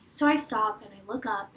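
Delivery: ordinary talk, nothing marked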